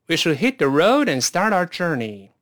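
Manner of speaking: 正常语速一口气连读; 带学生连贯读完整句，不拖音; 快一点. Shown as a demonstration. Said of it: "快点试试看". (快些示范，流畅连读)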